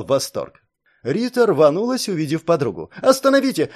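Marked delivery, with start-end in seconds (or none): 3.0-3.7 high, loud desperate cry; shouting. A narrator's reading. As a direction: yell for them to stop. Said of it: "кричала".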